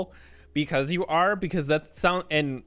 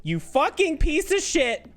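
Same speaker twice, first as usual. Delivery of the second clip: higher, punchier curse; outraged curse; 9 semitones higher than usual. Real, not acted. Outraged curse hurled at the game after her Sim dies. Cursing again, louder.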